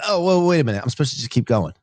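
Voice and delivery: ordinary talk, nothing marked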